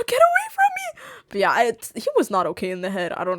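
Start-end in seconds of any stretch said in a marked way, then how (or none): 0.1-1.0 high squealed whine after a gasp; hammed-up high-pitched disgust; mock recoil. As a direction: squeal it as if backing off. Acted recoil from the man.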